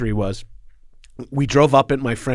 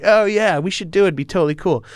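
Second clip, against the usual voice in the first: imitating breezy persuasion; 6 semitones higher than usual. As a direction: talk them into it, like a cocky teen. Boys breezily talking the girls round.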